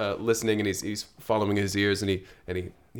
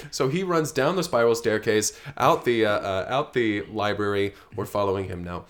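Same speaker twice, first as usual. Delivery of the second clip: ordinary talk, nothing marked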